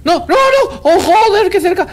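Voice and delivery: high-pitched burst of alarm; alarmed yelping. Real, not acted. Player narrowly escaping, alarmed yelping. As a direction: cry out at a near miss. Near miss in game.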